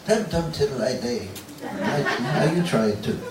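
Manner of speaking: playful mock Liverpudlian accent, lifted in pitch; Hobbit-like Scouse. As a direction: imitate Scouse like a Shire rhyme. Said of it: "Doesn't that sound like, you know, The Hobbit".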